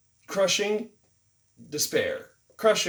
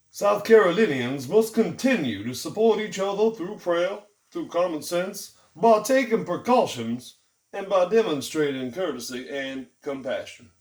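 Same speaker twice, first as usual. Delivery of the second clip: Governor McMaster; 4 semitones higher, 5 dB louder than usual. Retold or imitated. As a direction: drag it out like a Southern governor. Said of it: "that's how he really, really talks".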